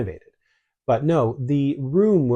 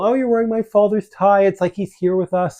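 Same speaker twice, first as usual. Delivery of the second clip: much higher and louder, imitating a moved mother; imitating emotional mom; 9 semitones higher, 6 dB louder than usual. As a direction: voice her being touched and overwhelmed. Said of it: "she got all emotional".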